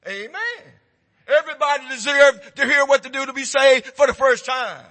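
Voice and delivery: loud, driving pulpit preaching; preaching at full force. Acted. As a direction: hammer it home to the congregation.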